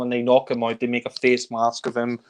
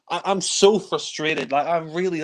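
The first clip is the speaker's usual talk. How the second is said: ordinary talk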